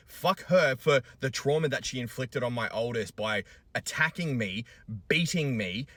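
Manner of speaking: loud, high, raging curses at his ex; furious rant. Real, not acted. Father venting at his abusive ex, furious loud shouting. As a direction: spit out every line in real fury. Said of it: "I'm fucking furious".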